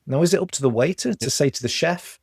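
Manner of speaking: ordinary talk, nothing marked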